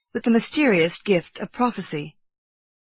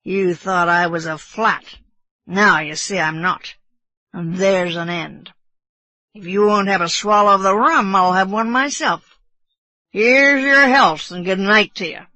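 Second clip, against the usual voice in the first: put-on blustering drunk sailor, swelling to a loud toast; drunken bosun; 5 dB louder than usual. A narrator's reading. Drunk bosun toasting Kiawe goodbye, bluster building louder. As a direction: dismiss him tipsily, then toast loudly. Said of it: "how drunken it appeared".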